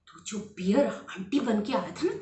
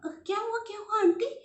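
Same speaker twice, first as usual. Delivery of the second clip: put-on startled girl; 12 semitones higher, 4 dB louder, faster than usual. Chair breaks, girl startled.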